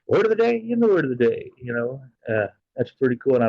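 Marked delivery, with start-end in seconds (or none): 0.1-1.5 much higher sing-song announcer lilt; playful mimic of a friend's catchphrase; imitating the word-of-the-day lady. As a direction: copy her cheerful announcement, pitched way up. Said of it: "I love the way she does that too".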